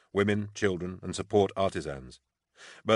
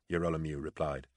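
plain reading voice

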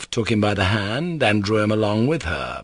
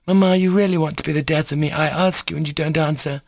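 put-on quick, higher girlish complaint; exasperated girl; 7 semitones higher, faster than usual. Lisa reproaches Mamma for not answering, exasperated. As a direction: complain impatiently in a raised young voice. Lisa scolds her mother through door.

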